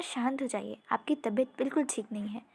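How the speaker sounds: ordinary delivery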